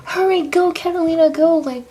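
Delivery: loud, high-pitched urging; urgent call. Retold or imitated. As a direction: shout at her to hurry out. Coworkers urging her to leave.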